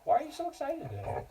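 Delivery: high cooing pet voice; baby talk to dog. Acted. Teacher coos at her excited dog, high sing-song pet talk.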